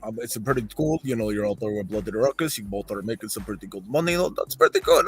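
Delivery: low, halting Russian bandmate impression; Scum. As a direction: impersonate a Russian friend with a grievance. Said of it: "Gino had Scum on speaker. He's like".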